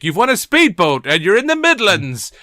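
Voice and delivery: high, loud mock prize announcement; hammed-up TV quiz host revealing the star prize; game show host. Host parodying Bullseye for the winner's prize, excited game show announcement. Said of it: "get a bit like Bullseye now".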